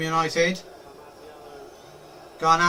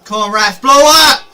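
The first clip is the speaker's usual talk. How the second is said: loud plea for the whistle; pleading with the referee; 6 dB louder than usual. Real, not acted. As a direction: yell at the ref to end it.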